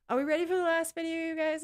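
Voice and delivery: fast, high, loud hype voice; hyped. Acted. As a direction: pump up the chat. Hyping up the audience.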